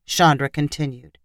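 ordinary delivery, nothing marked

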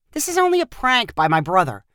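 ordinary delivery, nothing marked

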